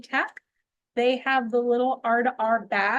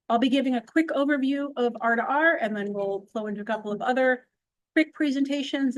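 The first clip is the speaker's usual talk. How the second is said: usual speaking voice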